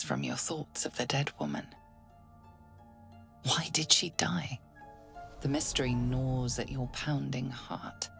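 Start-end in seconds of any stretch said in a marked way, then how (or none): none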